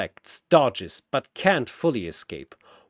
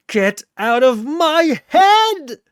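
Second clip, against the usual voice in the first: much higher, louder, slow desperate scream; anguished scream; 13 semitones higher, 9 dB louder, slower than usual. Acted. Sephiroth screaming as the spirits break his mind. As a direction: scream as your mind is invaded. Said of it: "He screams".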